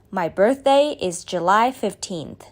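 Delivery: ordinary delivery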